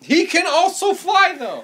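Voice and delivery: excited protest. Real, not acted. Player protests the fall.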